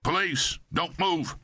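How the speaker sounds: high, sharp command to freeze; shouting a police command. A narrator's reading. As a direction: bark it at the robber.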